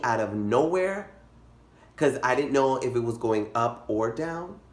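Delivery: usual speaking voice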